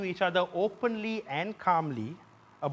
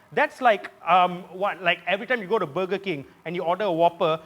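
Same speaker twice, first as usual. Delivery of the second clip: usual speaking voice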